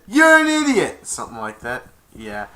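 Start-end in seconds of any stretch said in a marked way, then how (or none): 0.1-1.1 high, loud shouted insult; shouting. Retold or imitated. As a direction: yell it back. Echo answers back.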